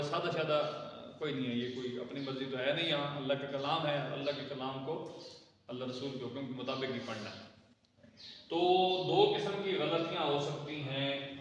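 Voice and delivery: usual speaking voice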